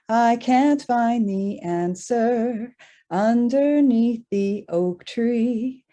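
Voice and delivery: ordinary delivery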